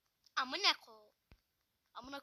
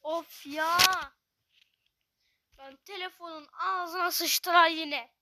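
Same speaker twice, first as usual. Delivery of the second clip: much louder, furious complaint at the phone; angry rant; 16 dB louder than usual. Real, not acted. Gamer fed up with his lagging phone, loud angry rant. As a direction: rant like you're about to smash the screen.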